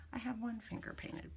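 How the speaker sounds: whispered aside. Real, not acted. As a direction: drop to a whisper. Whispered aside about painting.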